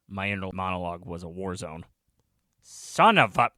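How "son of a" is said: loud, higher-pitched burst of frustration; exasperated curse. A narrator's reading. Teacher's inner monologue as war zone, exasperated. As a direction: curse loudly at your rival in your head.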